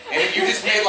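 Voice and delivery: ordinary talk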